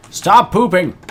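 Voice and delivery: loud, high scolding yell. Real, not acted. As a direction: shout at the animals. Yelling at farm animals.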